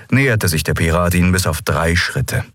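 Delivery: usual speaking voice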